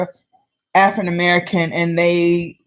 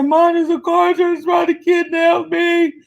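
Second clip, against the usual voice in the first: put-on frightened crying child; 9 semitones higher than usual. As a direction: shout it higher, terrified and tearful. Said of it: "I said".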